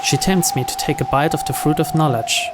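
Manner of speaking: plain reading voice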